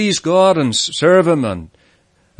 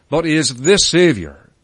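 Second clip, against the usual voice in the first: "the" stressed as a strong "thee". Shown as a demonstration. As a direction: punch "the" to mean the one and only. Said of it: "The emphasis could be placed as well".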